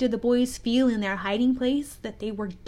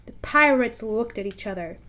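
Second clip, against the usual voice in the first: ordinary delivery, nothing marked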